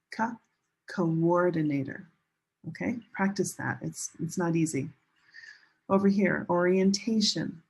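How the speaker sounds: ordinary talk, nothing marked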